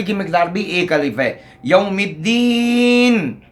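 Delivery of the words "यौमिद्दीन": यौमिद्दीन very slow and higher, drawn vowels; careful recitation. Shown as a demonstration. Teacher recites यौमिद्दीन slowly for students. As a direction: draw out यौमिद्दीन evenly. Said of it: "मीम को एक अलिफ के बराबर खींचना है".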